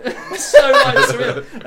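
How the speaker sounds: high, loud, laughing through the words; helpless amusement breaking into speech; real laughter. Real, not acted. Laughing at his own anecdote.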